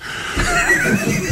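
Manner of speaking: rire très haut, en fausset; éclate d'un rire perçant; éclat de rire aigu. Real, not acted. (rire en fausset)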